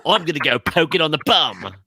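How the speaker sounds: high, wildcat Aussie wildlife host voice; Aussie nature presenter, whispering excitedly about a snake; Australian accent. Acted. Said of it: "saying it like Australian".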